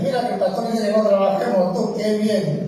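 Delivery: high, loud, mocking voice of a churchgoer. Pastor mocks a churchgoer twisting his words, high and loud. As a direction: sneer it like a gossiping member.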